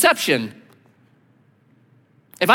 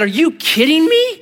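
high, loud, incredulous cry; amazed disbelief; 12 semitones higher, 6 dB louder than usual. Retold or imitated. Pastor reacting to the teller's cash trick, loud and amazed. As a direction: shoot up in astonished disbelief. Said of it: "I said".

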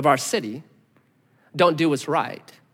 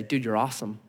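gentle encouragement; 8 semitones lower, 8 dB quieter than usual. Retold or imitated. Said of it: "somebody come and say".

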